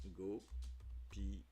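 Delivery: Gopiya said very slowly, every syllable apart; slow demo. Shown as a demonstration. Slowly showing viewers the written form's sound. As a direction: draw out Gopiya deliberately. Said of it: "c'est au niveau de l'écriture".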